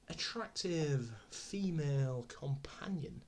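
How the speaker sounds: slow, breathy whisper; whispered aside. Real, not acted. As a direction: whisper it slowly, like a guilty confidence. Coy aside about the actress.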